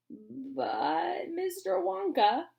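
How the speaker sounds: put-on high halting old man; stammered. Grandpa Joe stunned by Wonka's offer, stammering. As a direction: stammer it out in disbelief. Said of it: "stammered Grandpa Joe".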